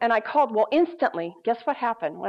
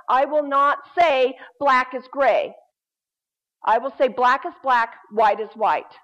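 bold declaration; 3 semitones higher, 5 dB louder than usual. Retold or imitated. Quotes own declaration from last service.

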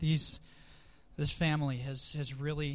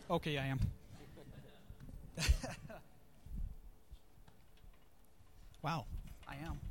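getting emotional; 17 dB quieter than usual. Real, not acted. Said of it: "I'm not gonna get emotional".

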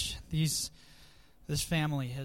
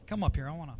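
ordinary talk

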